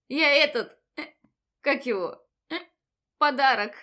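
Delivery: tipsy, hiccuping loudly; drunk with hiccups. Acted. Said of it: "пьяно икнула я". (пьяно, с иканием)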